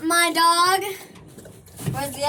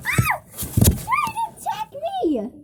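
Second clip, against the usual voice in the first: much higher, slow startled cry; startled shriek; slower than usual. Real, not acted. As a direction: yelp as the cat attacks. Kitten attacks her.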